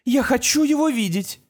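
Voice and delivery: high, firm demand; insistent mother. A narrator's reading.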